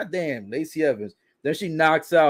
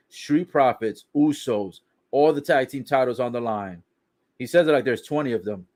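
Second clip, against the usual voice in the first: ordinary talk, nothing marked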